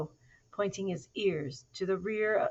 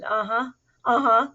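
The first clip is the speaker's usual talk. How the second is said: higher, loud chorus of uh-huhs; eager agreeing; 5 semitones higher, 12 dB louder than usual. A narrator's reading. All the dogs agreeing with their leader, eager. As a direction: build the agreement louder each time. All the doggies nod together.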